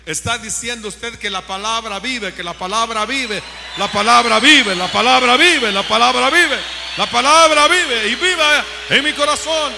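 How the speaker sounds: preacher's chant swelling into a full-throated shout; building to shout. Real, not acted. Preacher rousing the congregation that the word lives, building to a shout. Preacher repeats rallying cry.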